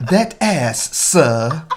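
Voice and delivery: mock-posh British assistant; British Siri. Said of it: "changed the accent on mine".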